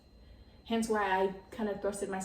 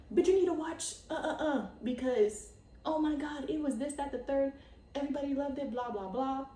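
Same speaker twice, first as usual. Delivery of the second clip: lightly acted, higher-pitched insistent retelling; mock gushing elder; 5 semitones higher than usual. Imagined grandparent telling grandkids about the show, mock gushing. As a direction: gush like a pretend grandparent, higher and tumbling on.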